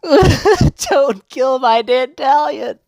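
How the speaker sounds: squeaky, slow plea with a laugh; high, slow mock whimper; high pleading whine. Real, not acted. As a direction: beg the game in a high drawn-out voice. Pleading with game not to kill unit.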